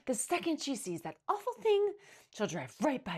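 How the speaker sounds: loud, high commanding alarm; crying out. A narrator's reading. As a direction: order her, loud and appalled. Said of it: "Missus McSnoot cried".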